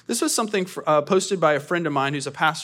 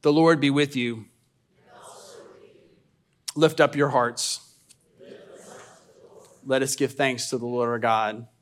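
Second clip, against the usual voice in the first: solemn call, louder; liturgical call; 4 dB louder than usual. Acted. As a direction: call the people to worship.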